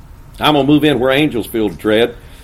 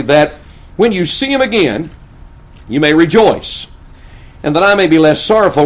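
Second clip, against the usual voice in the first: loud, raised, emphatic pulpit delivery; ringing sermon proclamation; full-force preaching; 6 semitones higher, 7 dB louder than usual. Acted. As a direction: preach it out to the whole church.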